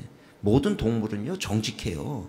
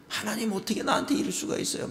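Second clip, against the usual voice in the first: high, whining protest; resentful complaint to God; 11 semitones higher than usual. Retold or imitated. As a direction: cry out at God in reproach, pitched up high. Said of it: "막 하나님을 원망해".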